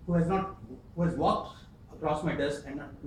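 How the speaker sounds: usual speaking voice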